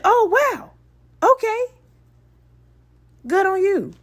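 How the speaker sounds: high-pitched, hammed-up amazement; imitated surprise. Man reacting to her real age, mock-surprised high voice. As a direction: mimic his surprise way up high.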